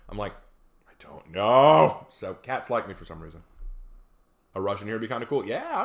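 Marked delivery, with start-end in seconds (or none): 0.9-2.1 loud, drawn-out retold protest; big mock-clueless answer; exaggerated bafflement. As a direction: stretch it out slowly and loudly, hammed-up.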